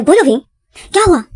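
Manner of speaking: loud, high, sharp challenge; angry demand. Retold or imitated. Dad shouts at Wing to stop pretending, furious. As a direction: shake him and shout for an answer.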